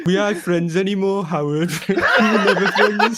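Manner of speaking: cracking up as he delivers the line; giggling dramatic breakup line; laughing mock breakup. Mocking Howard breakup line.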